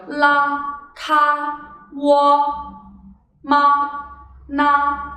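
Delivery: la, ka, wo, ma, na all long and level; flat-tone syllable drill on la, ka, wo, ma, na; first tone. Shown as a demonstration. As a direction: hold each of "la, ka, wo, ma, na" on one straight pitch. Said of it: "This is how the first tone".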